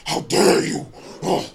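a touch of a pompous whiny critic; mocking the troll. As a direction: play him as a prissy offended troll. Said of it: "And he said".

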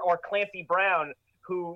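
usual speaking voice

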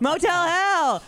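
slow, high, hammy horror announcer; drawn-out spooky title. Pretend. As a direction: stretch it out like a horror host.

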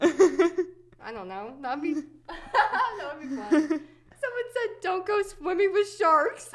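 slow, loud, laughing through the words; laughing hard. Real, not acted. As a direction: barely get it out for laughing. Laughing hard while recalling comments.

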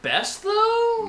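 high, doubtful question; incredulous. Real, not acted. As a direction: disbelief at the claim. Challenging the claim.

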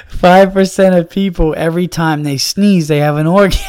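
words pushed out through real laughter; barely holding it together, laughing; laughing while talking. Real, not acted. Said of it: "I'm crying of laughter".

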